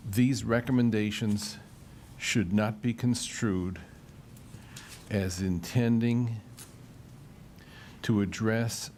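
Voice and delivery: measured, phrase-by-phrase reading for note-takers; slow dictation. Real, not acted. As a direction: read slowly with long pauses between phrases. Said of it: "I'll read it slowly".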